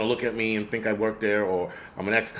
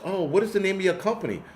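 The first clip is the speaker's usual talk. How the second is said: higher mock voice of a woman asking politely; imitated old woman; 7 semitones higher than usual. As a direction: play the inquisitive customer, pitched well up. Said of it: "And she goes".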